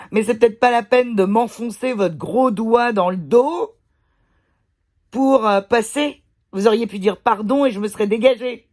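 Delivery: retold outraged reproach, loud and high; indignant retort. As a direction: act out scolding a rude stranger, loudly. Retold angry retort to rude lady.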